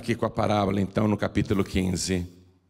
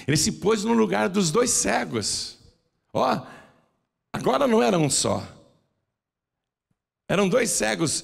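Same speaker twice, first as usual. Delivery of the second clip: raised preaching voice; 10 semitones higher than usual. Real, not acted. Preacher rises in pitch emphatically.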